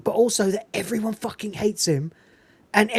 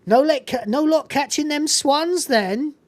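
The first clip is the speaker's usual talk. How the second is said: rural accent impression; 7 semitones higher than usual. Shopkeeper teasing the sergeant, a light West Country accent. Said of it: "she's like".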